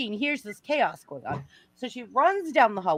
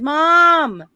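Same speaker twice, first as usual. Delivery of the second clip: calling out; 8 semitones higher, 9 dB louder than usual. Retold or imitated. Calling mother in the kitchen.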